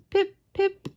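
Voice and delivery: high little pip pip; robin peep imitation. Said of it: "I'm not very good at bird sounds".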